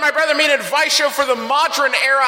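announcer intro. Acted. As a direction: announce the show like a host. Show intro announcer read.

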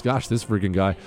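usual speaking voice

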